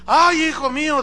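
high, exclaiming acted cry; Saúl calling back. Saúl sees David and answers him, high emotional exclamation. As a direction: cry out to a son on seeing him.

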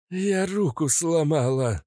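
high, slow pained growl. Acted. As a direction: growl it out through the pain. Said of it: "прорычала кобра".

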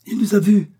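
loud, high exclamation; excited cry. A narrator's reading. Doctor seeing that Joey spotted the balloon, excited cry. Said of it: "s'écria le docteur".

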